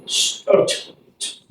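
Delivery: "sh" and "ch" as pure air noises; voiceless sh, ch. Shown as a demonstration. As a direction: hiss "sh", click out "ch", no throat voice. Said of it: "same from your mouth".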